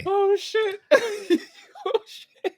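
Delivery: high, laughing oh shit. Real, not acted. Laughing at his friend's blunt take, high and giggly. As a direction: crack up while saying it.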